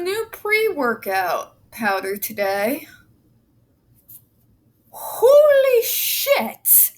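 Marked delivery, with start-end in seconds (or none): 4.9-6.9 high, drawn-out gasping cry; breathless shout. Real, not acted. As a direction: gasp it out, high and stretched. Pre-workout kicking in, breathless.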